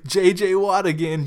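normal talking voice

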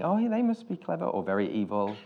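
high-pitched, lightly impressed quote; mock awed realization. Imitating Americans hearing a British accent, mock impressed. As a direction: voice an easily impressed listener, pitch lifted.

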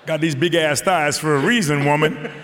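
loud, laughing comeback at a critic; defiant. Real, not acted. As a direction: fire back cheekily, laughing. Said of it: "answering critic Maureen".

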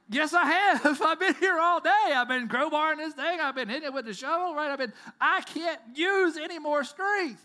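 loud, high protesting shout with a laugh; frustrated son. Retold or imitated. Boy protesting to his dad about the stump, loud exasperated shout. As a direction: insist hotly, exasperated. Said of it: "And the son's like".

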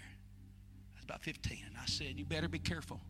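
hushed. Real, not acted. Hushed retelling of warning.

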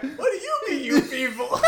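high-pitched echo through laughter; laughing, squeaky retold challenge; dude in the back. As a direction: imitate a background character chiming in, cracking up. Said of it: "then the dude in the back".

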